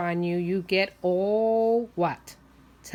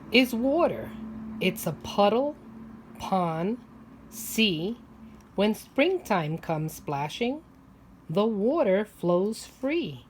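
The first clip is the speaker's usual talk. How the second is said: put-on reading aloud to children. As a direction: read each line to little kids, savoring it. Reads picture book to children.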